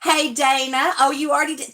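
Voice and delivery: ordinary delivery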